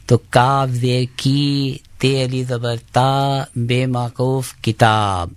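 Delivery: کاف زیر کی، تے علی زبر تا، بے موقوف کتاب spelt slowly, syllable by syllable; slow, deliberate spelling of کتاب, like a teacher drilling a class; ہجے کرتے ہوئے آہستہ آہستہ; spelling out a word. Shown as a demonstration. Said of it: "ہجے کرنا".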